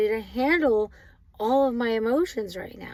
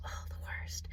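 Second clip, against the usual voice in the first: a breathy whisper, almost to herself; whispered aside; 15 dB quieter than usual. Real, not acted. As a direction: share it like a secret groan. Groaning aside about stepping on Lego.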